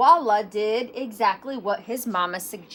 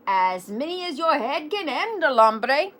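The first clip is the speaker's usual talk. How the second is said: poorly faked Spanish accent, pitched up; 5 semitones higher, 5 dB louder than usual. A narrator's reading. Kitty boy answering his reflection, shaky attempt at Spanish accent. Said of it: "very best Spanish accent".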